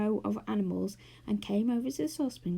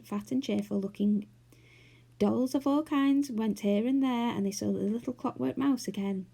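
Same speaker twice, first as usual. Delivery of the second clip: plain reading voice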